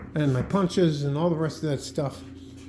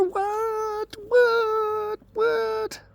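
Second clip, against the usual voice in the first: pet talk; much slower than usual. Real, not acted. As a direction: coo at the dog like a puppy. Cooing at his dog Bella.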